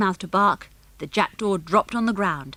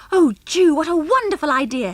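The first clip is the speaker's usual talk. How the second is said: high, loud burst of admiration; delighted exclamation; 7 semitones higher, 5 dB louder than usual. A narrator's reading. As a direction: gush with delight at the idea. Anne delighted by the plan.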